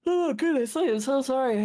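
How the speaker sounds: talking through a yawn; stretched yawning words. Real, not acted. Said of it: "I keep yawning".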